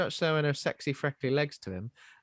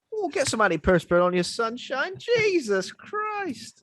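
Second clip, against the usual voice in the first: mock complaining; 10 semitones higher than usual. Voicing the man complaining about sweat.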